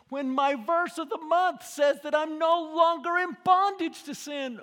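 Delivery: loud, high, pleading cry to God; shouted prayer of repentance. Acted. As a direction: cry out your sorrow to God.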